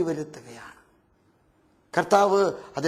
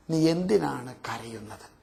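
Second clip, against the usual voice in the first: soft tender question; 8 semitones lower, 5 dB quieter than usual. Acted. Jesus gently asks weeping Mary.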